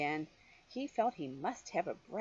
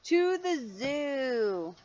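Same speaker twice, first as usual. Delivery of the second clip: happy reveal; 6 semitones higher, 5 dB louder, much slower than usual. A narrator's reading.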